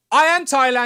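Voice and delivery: loud, high mock declaration; mock proclamation. Co-host declaring himself Thailand as a joke, loud mock proclamation.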